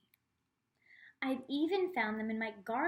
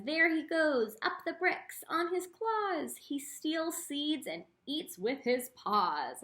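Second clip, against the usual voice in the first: lilting, higher read-aloud rhyme, slower at the end; 5 semitones higher, 3 dB louder than usual. Acted. Teacher reads a squirrel rhyme to the class, bouncy sing-song. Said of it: "And it says".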